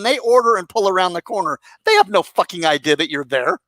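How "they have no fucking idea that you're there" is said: ordinary talk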